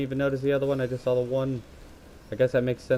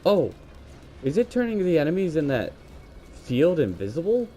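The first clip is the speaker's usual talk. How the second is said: surprised; 7 semitones higher than usual. Real, not acted. Sudden discovery in game.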